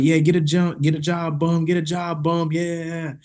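mocking chant, retold; retold taunt. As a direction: taunt someone chasing you. Taunting a chasing homeless man.

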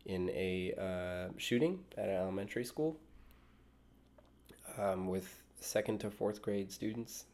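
somber. Real, not acted. Reporting school shooting, slow.

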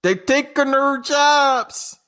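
high, slow mock complaint; mock nativist rant. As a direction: drag it out in a put-on rant voice.

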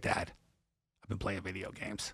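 quiet, low mumbled admission; sheepish confession. Retold or imitated. Son admits to dad he's been gaming, sheepish. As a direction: imitate a son owning up, very soft and low.

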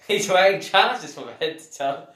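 mock the phrase through laughter; laughing mockery. Real, not acted. Mocking repeat, laughing.

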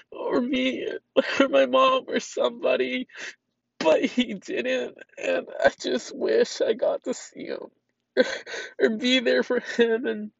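high, breaking voice, fighting back tears; crying. Real, not acted. Grieving owner tells viewers about her cat, crying. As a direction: voice cracking while trying to keep talking.

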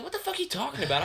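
high-pitched quarrel reenactment; indignant comeback. Retold or imitated. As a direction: act out disbelief at an accusation. Acting own angry reply in quarrel.

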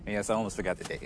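usual speaking voice

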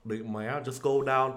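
mock Vietnamese-accented parent. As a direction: send the kid off in a put-on Vietnamese accent. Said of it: "talk to my kids with a Vietnamese accent".

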